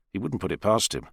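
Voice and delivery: plain reading voice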